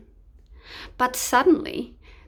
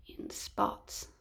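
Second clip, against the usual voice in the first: hushed reveal; 10 dB quieter than usual. A narrator's reading. Punchline reveal.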